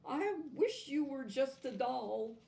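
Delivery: high, tearful plea; a little girl crying it out; sobbing. A narrator's reading. Natasha sobbing to the doll, high and tearful. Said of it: "she said between sobs".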